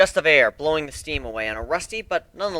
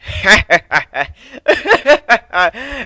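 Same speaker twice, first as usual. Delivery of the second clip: hearty laugh; 11 semitones higher, 11 dB louder than usual. Acted. Sea dog laughs at them.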